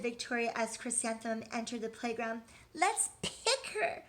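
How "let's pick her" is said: high, mocking girl voice; teasing child. Girl teasing a classmate, high mocking voice. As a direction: sneer it out to the playground. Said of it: "said Rita".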